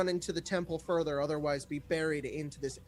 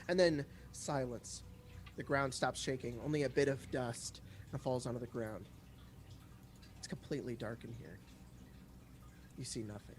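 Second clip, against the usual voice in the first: softer, lower, slowing narration into darkness; hushed scene-setting narration; 4 semitones lower, 7 dB quieter than usual. A narrator's reading. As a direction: let the scene fall quiet and dark. GM sets the dark, quiet scene.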